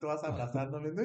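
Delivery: ordinary talk, nothing marked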